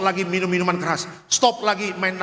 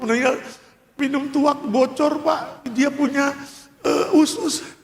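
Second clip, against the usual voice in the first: imitating widow; 9 semitones higher than usual. Voicing the widow's answer.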